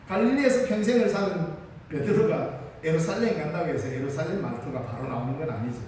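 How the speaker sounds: normal talking voice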